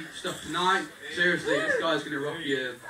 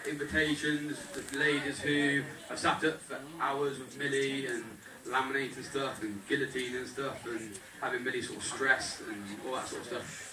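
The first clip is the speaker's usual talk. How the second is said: ordinary delivery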